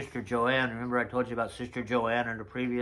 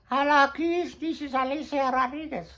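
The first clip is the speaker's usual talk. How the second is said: much higher, louder put-on voice of a Mexican woman; Alicia's voice; 8 dB louder than usual. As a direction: greet the kids as Alicia in a high falsetto. Voices Alicia introducing herself.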